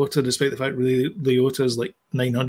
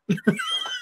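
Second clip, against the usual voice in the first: high burst of laughter; 5 dB quieter than usual. Real, not acted. Big laugh after joke.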